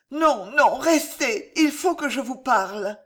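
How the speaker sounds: don't let him leave; desperate plea. Acted. Woman begging the monk to stay, desperately.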